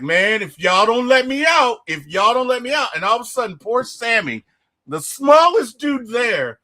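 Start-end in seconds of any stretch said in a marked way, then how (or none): 0.0-2.9 imitating Stack pleading. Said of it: "he's like".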